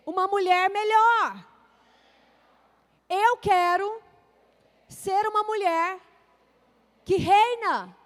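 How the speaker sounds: loud declaration for the crowd to repeat; proclaiming repeat after me. Shown as a demonstration. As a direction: proclaim each phrase slowly so they echo it. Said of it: "Fala assim comigo". (declaração alta e pausada para a igreja repetir)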